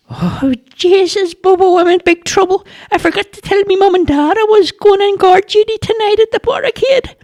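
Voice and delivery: very high, rushed panic; a boy realising he's in trouble; panicky lad. A narrator's reading. Charlie tells Bobo he forgot his parents, high panicky voice.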